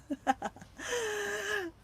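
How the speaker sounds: high-pitched laugh. Real, not acted. Host amused by wearing one sock, high laugh. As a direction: burst into high giggles.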